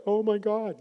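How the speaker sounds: mock stunned disbelief; imitating shocked gasp. Couple recalling old hurt, shocked "oh my God". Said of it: "they're both like".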